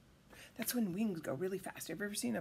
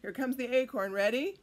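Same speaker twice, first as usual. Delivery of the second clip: ordinary delivery, nothing marked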